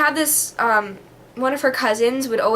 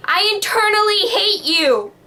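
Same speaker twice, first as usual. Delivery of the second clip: put-on high, pert kid voice; kid's made-up phrase; 9 semitones higher than usual. Imitating her child self saying her made-up phrase. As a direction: say it like a sassy little kid. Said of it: "kids that said".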